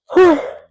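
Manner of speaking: loud, high yawn that breaks into the talk; big yawn. Real, not acted. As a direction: let out a long real yawn. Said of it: "I'm gonna be yawning".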